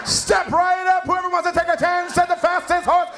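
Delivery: carnival barker. Pretend. Comic mimicking a fair barker to a woman. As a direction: hawk the games like a carnival barker.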